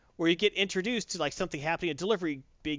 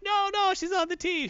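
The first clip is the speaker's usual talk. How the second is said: mock high-pitched reassurance. Vanguard reassuring in comic retelling.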